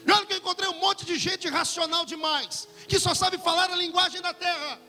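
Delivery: high-pitched, driving denunciation; fervent pulpit tirade; impassioned preaching. Real, not acted. Preacher denounces dream thieves.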